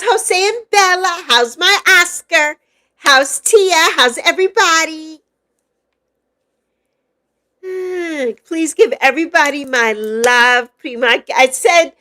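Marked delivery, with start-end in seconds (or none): none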